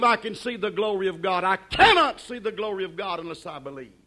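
ordinary talk

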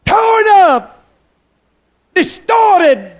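very high, loud outburst; anguished preacher's shout. Acted. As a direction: hurl out each word separately. Climax describing the beaten face.

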